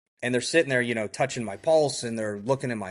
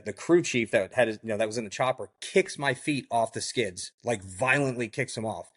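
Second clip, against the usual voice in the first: ordinary delivery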